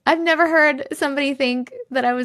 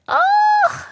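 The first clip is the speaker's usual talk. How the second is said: high squeal; 5 dB louder than usual. Real, not acted. Overwhelmed by sweet comments.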